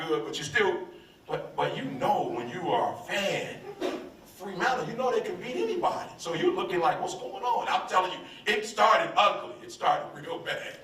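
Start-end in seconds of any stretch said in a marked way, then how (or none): none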